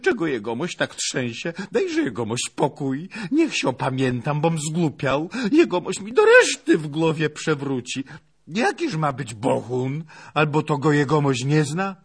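high-pitched protesting lad; flustered servant. Acted. (oszołomiony, protestujący pachołek)

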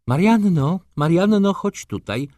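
high, loud call from another room. A narrator's reading. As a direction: summon the maid, pitch way up. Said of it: "zawołała ze swego pokoju baronowa".

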